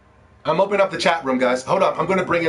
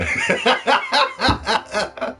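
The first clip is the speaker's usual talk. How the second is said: laughing; 10 semitones higher than usual. Real, not acted.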